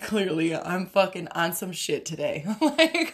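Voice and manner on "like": laughing. Real, not acted. Laughing at her own attitude.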